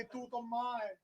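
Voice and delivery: soft, choked pleading daughter; a little girl's hushed crying plea; tearful plea. Acted. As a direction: plead through quiet tears. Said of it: "رو کے دی".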